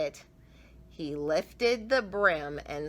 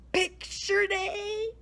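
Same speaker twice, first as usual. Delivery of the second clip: high, slow, shocked question; dismayed boy can't believe it's picture day; sputtering; 14 semitones higher, much slower than usual. A narrator's reading. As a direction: sputter it out in disbelief. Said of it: "sputtered Oliver".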